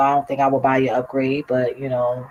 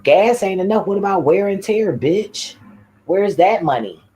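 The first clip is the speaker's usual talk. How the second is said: higher, louder heated rant; angry rant; 6 semitones higher, 5 dB louder than usual. Real, not acted. Complaining about the streets wrecking her car, loud and heated. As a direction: let it boil over.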